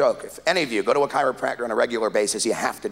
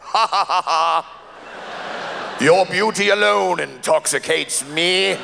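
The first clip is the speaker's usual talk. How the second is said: slow, laughing, gently higher; mock charming husband; 3 semitones higher, slower than usual. Drunk husband sweet-talking his wife, slow laughing charm. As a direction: schmooze his way out.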